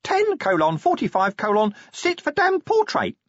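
chirping out an alarm reminder; imp announcing. Acted. Imp reads out appointment.

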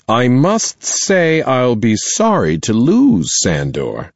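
a headmistress weighing her words; stern principal voice. Acted. Principal addresses parents.

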